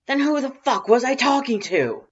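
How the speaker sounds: little brother yelling in bewilderment; loud baffled shout. Acted. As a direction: bellow it in confusion. Boss shouting.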